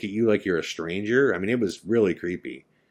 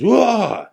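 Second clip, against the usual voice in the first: loud, high startled yell; freaking scream; 8 dB louder than usual. Retold or imitated. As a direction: imitate a terrified scream on waking. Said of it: "lets out this freaking scream".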